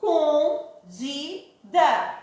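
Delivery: ES-CON-DI-DA, slow, separate beats; syllable by syllable. Shown as a demonstration. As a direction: split Escondida into four drawn-out syllables. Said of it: "Escondida tem quantas".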